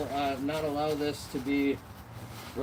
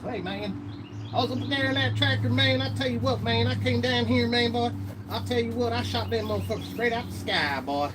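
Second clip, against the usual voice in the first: loud, high hammed-up Alabama accent; 10 semitones higher, 6 dB louder than usual. Mocking how Alabama folks talk, loud Alabama accent. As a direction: crank up a rowdy Alabama good old boy. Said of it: "Compared to the Alabama voice where it's".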